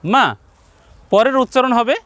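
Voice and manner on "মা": মা kept brief; a short, single-beat মা; short vowel. Shown as a demonstration. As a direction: say মা without stretching. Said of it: "অবশ্যই সংক্ষেপ হবে".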